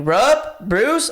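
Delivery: yelling names. Retold or imitated. Roommate calls up the stairs to friends, yelling. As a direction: shout friends' names into the house. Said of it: "I'm just yelling all my friends' names".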